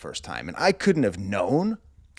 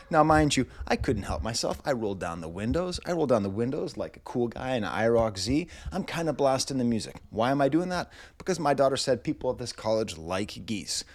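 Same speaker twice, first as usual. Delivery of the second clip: ordinary delivery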